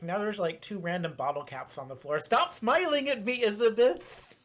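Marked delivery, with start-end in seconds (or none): 2.2-4.4 raised, teasing call-out; playful shout. Real, not acted. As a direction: call her out, louder and higher. Calling out a player.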